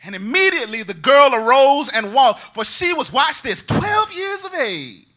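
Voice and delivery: loud, high, ringing sermon climax; thundering revival preacher; full-force preaching. Acted. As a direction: preach it out to the whole congregation.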